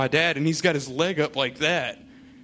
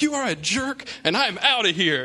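mock offended friend; 8 semitones higher, 4 dB louder than usual. Friend storms off, pranked.

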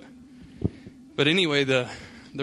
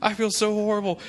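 hammed-up horrified friend; 8 semitones higher than usual. Friend reacting to the news in exaggerated horrified guilt. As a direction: overplay a friend's panicked remorse.

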